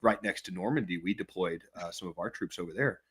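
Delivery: low, very soft imitation of a self-important Australian; mock Australian boasting. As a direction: murmur a humble-brag in mock seriousness, haltingly. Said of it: "they'll do the thing where they're like".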